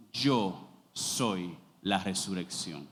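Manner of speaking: low, slow and grave; measured, reverent declaration; solemn Jesus quote. Acted. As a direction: let the words of Jesus fall slowly from deep down. Preacher voicing Jesus' words.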